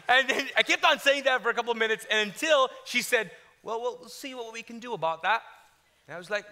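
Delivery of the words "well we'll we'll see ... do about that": lightly imitated British clerk, noncommittal; British accent. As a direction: put on a polite British woman. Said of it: "She's British".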